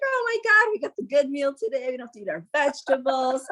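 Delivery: put-on high, thrilled kid voice, words rushing at the end; excited kids. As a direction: squeal like a kid who got the good meal. Kids cheering about hot dogs.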